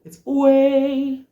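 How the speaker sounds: way sung high, loud and slow; right diphthong. Shown as a demonstration. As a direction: round the A diphthong in way. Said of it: "That's wrong".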